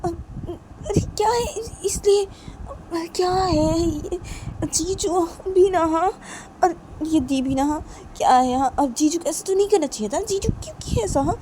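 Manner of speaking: put-on very high, complaining girl's voice; Rani, dismayed. Rani reacts to news about jiju and di, dismayed. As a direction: open on a loud high cry, then fret.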